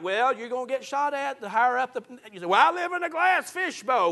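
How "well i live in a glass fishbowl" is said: high-pitched whiny objection in another's voice; put-on high complaining voice; mock complainer. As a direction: mimic someone complaining, pitched well up. Mimics a complaining church leader.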